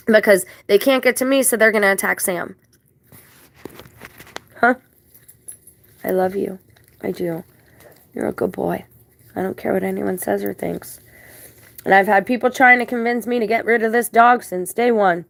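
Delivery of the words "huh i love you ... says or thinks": tender reassurance. Real, not acted. Woman telling her dog he's good, tender reassurance. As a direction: reassure your dog softly and sincerely.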